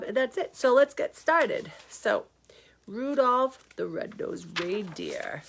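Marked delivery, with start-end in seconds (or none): 2.9-5.4 slow hushed whisper. Acted. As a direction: whisper the title slowly, storybook hush. Title read as story opening.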